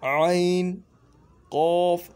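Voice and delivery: ain and qaf said loud from the throat; letter names demo. Shown as a demonstration. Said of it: "थोड़ा हल्क से निकल रहा है ना".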